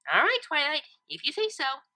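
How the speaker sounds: high pony voice giving in with a shrug; shrugging Pinkie. A narrator's reading. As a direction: shrug it off lightly.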